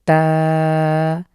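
বা held clearly in a careful recital; careful recitation. Shown as a demonstration. Tutor reciting the first letters for students, held. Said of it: "একটু শুদ্ধ করে পড়ার try করি".

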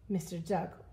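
ordinary delivery

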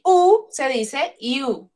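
U as Spanish "oo", then you as "iu"; English vowel name. Shown as a demonstration. Teacher showing students English U, slowly from "oo" to "you". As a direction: slowly set U "oo" against drawn-out you. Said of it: "Pero en inglés no".

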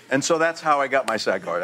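ordinary talk, nothing marked

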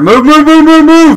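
very high, loud urgent yells; frantic yelling. Real, not acted. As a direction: scream at the team to move. Urging squad under fire.

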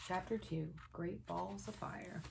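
ordinary delivery

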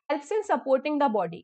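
ordinary talk, nothing marked

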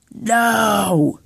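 loud, high yell of protest; alarmed shout. Acted. The player yells as the suit grabs him, loud alarmed shout. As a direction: cry out as it grabs you.

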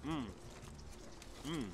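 humming while chewing; talking after swallowing; mouth full, chewing. Real, not acted. Seen 1: Bites chicken just before; closed, working mouth during the "mm"s.

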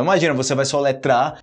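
ordinary talk

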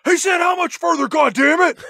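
high, loud mock-furious scream; hammed-up angry yell. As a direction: scream the demand like an impatient henchman. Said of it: "and screams".

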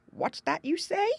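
high, put-on frog voice crying out; crying out in disbelief. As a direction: cry out as if you can't believe your ears. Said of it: "cried Grandfather Frog".

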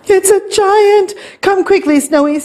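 high-pitched alarmed cry for help; panicked call. A narrator's reading. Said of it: "called Stanley".

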